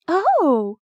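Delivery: high, loud flustered Oh!; a startled, flustered cry; flustered waitress exclaims in surprise; exclaiming. A narrator's reading. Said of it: "she exclaimed".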